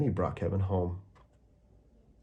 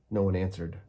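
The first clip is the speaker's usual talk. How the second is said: plain reading voice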